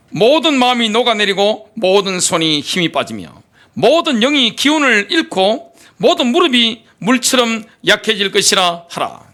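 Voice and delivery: ordinary delivery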